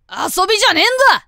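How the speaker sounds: 高く速い怒鳴り; 先輩を叱り飛ばす; angry shout. Acted. Rival scolds vice captain. (怒って速く怒鳴る)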